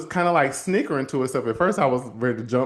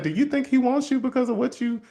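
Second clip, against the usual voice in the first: much higher, challenging her belief; high-pitched incredulous ask; incredulous question; 12 semitones higher than usual. Retold or imitated. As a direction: push back in disbelief. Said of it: "I was like".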